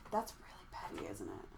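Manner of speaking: soft unvoiced admission; whispering. Real, not acted. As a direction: whisper it guiltily.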